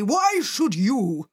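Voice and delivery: slow, loud, cross boss voice. Acted. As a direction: grumble it out slowly. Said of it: "said Mister Fat crossly".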